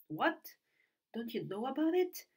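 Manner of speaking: high, loud, astonished question; surprised. A narrator's reading. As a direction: ask it in sheer disbelief.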